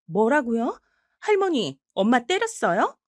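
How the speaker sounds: high, alarmed shout at grandma; shocked outcry. Acted. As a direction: blurt it out in disbelief. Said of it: "딸이 소리를 버럭 지르자".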